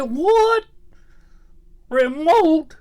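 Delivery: high squeaky what, then remote; startled old man squawk; shocked disbelief. Retold or imitated. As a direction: jump way up in pitch, baffled.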